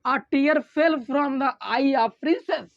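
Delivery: very slow, word by word for notes; slow dictation. Shown as a demonstration. Teacher dictating slowly to the class for notes. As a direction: read it out slowly so students can write. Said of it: "दूसरा example क्या है".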